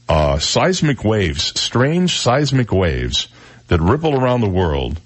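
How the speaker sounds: drawn-out, ominous mystery read; slow and dramatic. Acted. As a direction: stretch each word like a spooky teaser. Mysterious item, words drawn out.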